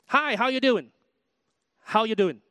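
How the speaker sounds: high, run-together Southern US greeting; American greeting. Retold or imitated. Preacher mimics Southerners greeting complete strangers, American accent. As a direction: imitate a cheery American stranger, words merged into one. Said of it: "as if it's one word".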